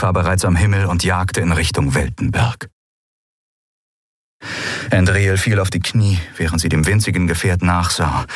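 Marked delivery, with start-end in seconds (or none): none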